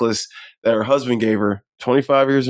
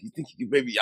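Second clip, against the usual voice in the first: sheepish hushed ask; 2 semitones lower, 10 dB quieter, faster than usual. Retold or imitated. Retelling him asking a favour sheepishly.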